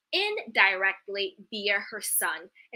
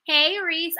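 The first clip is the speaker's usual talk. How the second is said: normal talking voice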